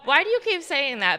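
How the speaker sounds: exasperated, pushing back; mock exasperated mom. Mom snapping at her daughter, exasperated. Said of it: "and I'm like".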